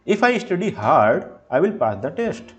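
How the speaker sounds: HARD held up high, "test" falling; non-final intonation. Shown as a demonstration. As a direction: lift "study hard", then come down to "test". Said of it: "used with unfinished thoughts". ("hard" पर चढ़ाव, "test" पर उतार)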